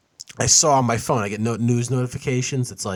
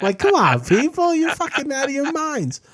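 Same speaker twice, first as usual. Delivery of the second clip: exasperated outburst; 13 semitones higher than usual. Real, not acted. Friend venting at arguing Facebook users, high exasperated yell. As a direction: throw up your hands at everyone.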